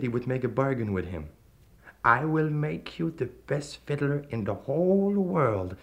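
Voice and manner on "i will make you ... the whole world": slow, silky devil voice; lightly voiced sinister stranger. A narrator's reading. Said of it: "he said".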